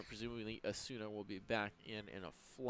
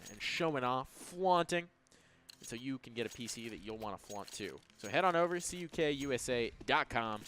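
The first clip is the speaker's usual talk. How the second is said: sponsor ad read; 6 dB louder than usual. Real, not acted.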